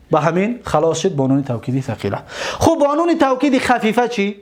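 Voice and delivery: ordinary delivery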